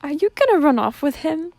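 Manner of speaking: high, loud tearful plea; choking back sobs. Acted. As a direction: ask through held-back sobs. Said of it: "choking back more sobs".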